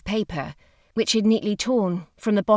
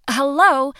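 louder, higher hello with a fall; announcing yourself on the phone; resolved greeting; 8 semitones higher, 7 dB louder than usual. A narrator's reading. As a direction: begin the phone message properly. Hollis starts again for the message.

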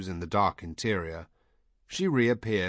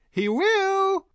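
top-of-the-voice, very high glee; piercingly high, loud and gleeful; joyful shriek; 8 dB louder than usual. A narrator's reading. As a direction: shriek it, overjoyed. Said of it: "the woman shrieked, joyful".

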